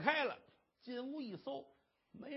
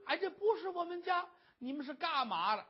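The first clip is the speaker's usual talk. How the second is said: alarmed protest; 8 semitones higher than usual. Acted. Wife finds herself at wrong house.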